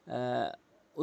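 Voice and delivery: ordinary delivery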